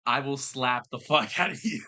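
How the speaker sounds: normal talking voice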